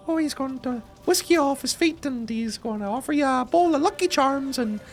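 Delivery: high-pitched caricature Irish lilt; mock Galway accent. Streamer mocking what chat hopes to hear, exaggerated Galway accent. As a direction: ham up a silly Galway accent. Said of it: "looking for the Galway accent".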